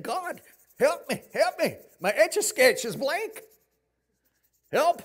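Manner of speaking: high, loud comic cry for help; mock panicked plea. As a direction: plead to God in hammed-up desperation. Acted panicked prayer.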